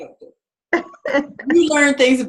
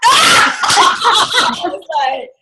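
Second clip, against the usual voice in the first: big high laugh, slow and loud; loud laughter; 8 semitones higher, 6 dB louder, much slower than usual. Real, not acted. Laughing at her own therapy story to the audience. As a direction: burst out laughing.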